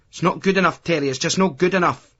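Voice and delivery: high, nagging scold at her son; mother scolding Terry, pitched high and nagging. A narrator's reading.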